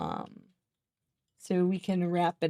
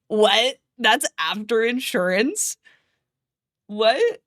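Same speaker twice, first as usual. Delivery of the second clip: shocked disbelief; 3 semitones higher, 4 dB louder, much slower than usual. Retold or imitated. Retold shocked reply.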